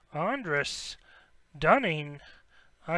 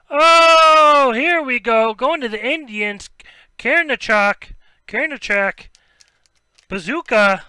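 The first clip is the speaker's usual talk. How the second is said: loud, high, rushing with thrill; can't contain the joy; real excitement; 6 semitones higher, 10 dB louder than usual. Real, not acted. Breaker announcing a rare hit to the Indians, loud and thrilled. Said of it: "Just got too excited".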